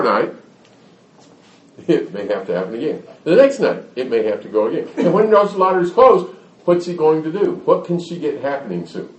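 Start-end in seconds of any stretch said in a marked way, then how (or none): none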